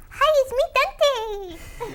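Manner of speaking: put-on high little baby voice. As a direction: squeak it like a small kid. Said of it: "your little baby voice".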